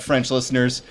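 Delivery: ordinary talk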